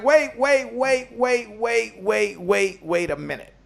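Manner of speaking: high, urgent "wait, wait" falling back down; alarmed protest. Retold or imitated. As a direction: stop them in disbelief.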